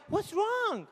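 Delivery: gently probe, imitating a worried wife; concerned wife. Wife asks stressed husband after work, imitated concern. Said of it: "your wife's like".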